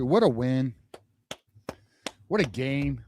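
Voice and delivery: usual speaking voice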